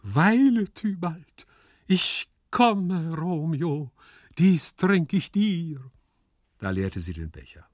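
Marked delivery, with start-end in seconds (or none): 0.0-5.8 high, drawn-out stage declamation; grand, slow-ending declamation; theatrical declamation. Acted. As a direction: declaim it like a tragic heroine's last toast. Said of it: "erschöpft von der Deklamation".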